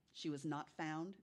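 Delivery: usual speaking voice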